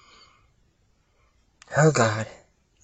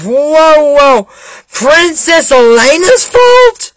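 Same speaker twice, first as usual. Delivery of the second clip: high-pitched, incredulous protest; acted indignant disbelief; 7 semitones higher, 3 dB louder, much slower than usual. Objecting to Elena being blamed, high incredulous protest.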